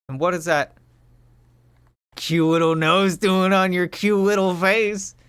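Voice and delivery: butter her up playfully; teasing flattery. Pretend.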